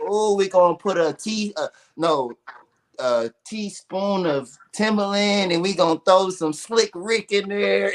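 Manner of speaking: high, cackling witch stirring a pot. Retold or imitated. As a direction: stir the cauldron like a witch. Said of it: "she talking like a witch".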